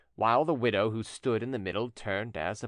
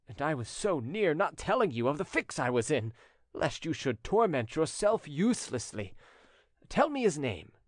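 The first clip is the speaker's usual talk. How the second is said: lightly voiced notary, pitched up and lively; eager notary; 6 semitones higher than usual. Notary begs widow for the lender's name, eager. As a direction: urge her eagerly for the name.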